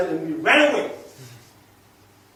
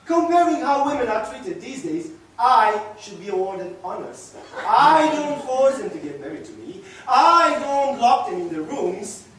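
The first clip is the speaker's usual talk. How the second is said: loud, raised voice arguing his case; indignant protest; 5 semitones higher, 7 dB louder than usual. Acted. Man defending his treatment of women to his companion, indignant. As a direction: lean hard on each point of your defence.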